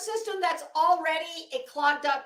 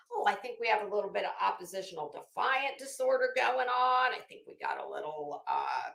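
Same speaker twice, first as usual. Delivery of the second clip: mock psychologist; 6 semitones lower than usual. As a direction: parody a therapist ticking off diagnoses. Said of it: "they decide that, you know".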